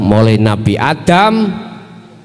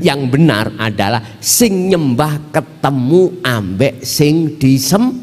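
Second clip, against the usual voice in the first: ordinary talk, nothing marked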